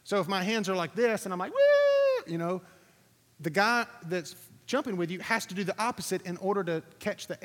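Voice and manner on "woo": very high, loud woo; a playful whoop that acts out a move in free fall; excited whoop. Shown as a demonstration. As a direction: whoop like you're flying.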